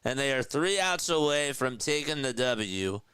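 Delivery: high, driving sportscaster call; announcer energy, pitch lifted throughout; live play-by-play. Real, not acted. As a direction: call the strikeout and wrap the inning.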